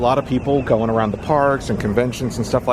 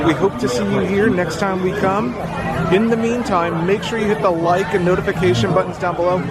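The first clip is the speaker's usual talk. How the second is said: higher, bright sign-off voice; upbeat outro; 6 semitones higher than usual. Acted. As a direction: wrap it up like a YouTube host.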